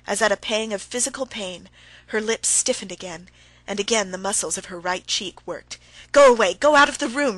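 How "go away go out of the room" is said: loud, high desperate shriek; wife shrieks at husband to get out; shrill shriek. A narrator's reading. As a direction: shriek him out of the room. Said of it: "she shrieked, still more shrilly".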